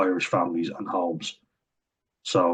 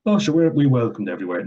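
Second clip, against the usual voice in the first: mocking quote; 5 semitones higher, 5 dB louder than usual. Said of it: "they seem to all be saying".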